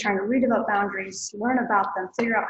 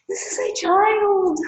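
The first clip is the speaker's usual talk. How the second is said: high, slow, spelled-out disbelief; exasperated retort; 11 semitones higher, 6 dB louder, slower than usual. Retold or imitated. Daughter tells jealous mother the obvious, high and slow. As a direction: talk to her like she can't grasp the obvious. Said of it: "And I'm like".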